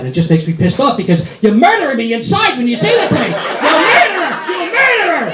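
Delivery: mock furious yell. Wife yelling murderer at husband, mock furious. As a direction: snap back to rage. Wife's apology turns to yelling.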